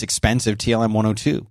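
ordinary delivery

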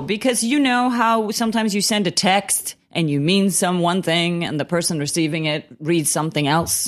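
ordinary delivery

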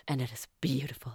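hushed, breathy whisper; a soft whispered aside. Real, not acted. Soft aside on a bonding scene.

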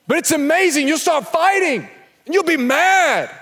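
normal talking voice

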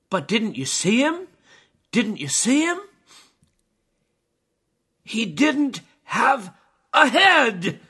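high, loud, building to a horrified cry; horrified revelation. Retold or imitated. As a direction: act out a shocked witness, pausing before the worst. Horrified climber reveals what he saw.

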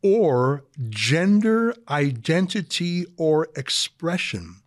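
slow, deliberate emphasis; slowed right down. Shown as a demonstration. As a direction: drag out each word slowly. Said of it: "and then slow right down here".

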